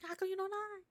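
high put-on voice; comic character voice. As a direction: do the goofy character voice. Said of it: "You do that really, really well".

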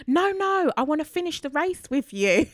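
high, loud call from behind; shouted out. Retold or imitated. Runner calling out to the narrator, loud and high. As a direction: shout it ahead so she hears. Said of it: "she shouted out to me".

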